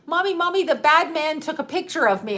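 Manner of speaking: high-pitched imitated child; mock small boy's urgent, high report; upset little kid. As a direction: tattle to mom like a five-year-old. Voices five-year-old son running home.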